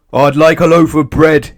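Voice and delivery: high, loud booming order. A narrator's reading. Mister Noisy booms at the deaf-pretending baker. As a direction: boom the order out. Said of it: "he boomed".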